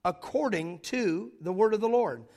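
loud, emphatic scripture preaching. Acted. Pastor preaching to the congregation, loud and emphatic. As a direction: proclaim the text with full force.

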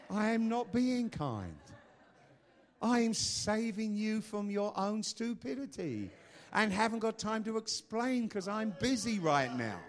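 loud, high, slow emphatic declaration; forceful rebuke. Acted. Preacher rebuking a hurt listener, loud and emphatic. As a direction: hammer it out at full voice. Same retort repeated at full force.

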